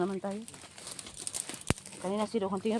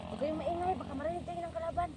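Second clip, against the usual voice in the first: high, small cautioning voice; mock hushed warning; 10 semitones higher, 7 dB quieter than usual. Vlogger warning the group about enemies, mock-secretive. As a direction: keep it high and held back, as if enemies listen.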